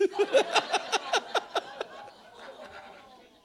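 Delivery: big hearty laugh, very high and loud. Real, not acted. Preacher laughing at his joke with the congregation, big loud laugh. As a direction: let a full laugh burst out.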